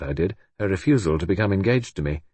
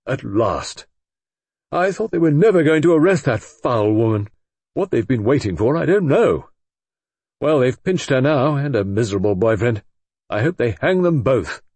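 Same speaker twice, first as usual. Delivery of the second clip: loud, high, heated tirade; excited outburst; 5 semitones higher, 6 dB louder than usual. A narrator's reading. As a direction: vent it all, eager and vindictive. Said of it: "He looked eager and excited".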